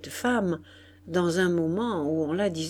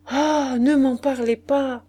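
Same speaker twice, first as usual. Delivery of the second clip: louder, higher exasperated outburst; exclaiming; 5 semitones higher, 5 dB louder than usual. A narrator's reading. Innkeeper answers the marquise about her sick lodger, exclaiming. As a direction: throw it out as a flustered exclamation. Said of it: "s'écria la maîtresse de l'hôtel".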